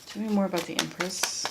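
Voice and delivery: quiet repeat. Real, not acted. Reader softly repeats her question to the cards, quiet. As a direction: ask the cards again under your breath. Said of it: "asking the cards again".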